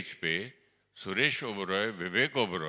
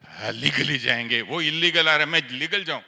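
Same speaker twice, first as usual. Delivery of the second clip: loud, high sarcastic "legally जाएंगे" thrown back; mocking anger; 6 semitones higher, 8 dB louder than usual. Mocking Didi's advice angrily on the phone. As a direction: spit her words back in disbelief. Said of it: "तो गुस्सा है".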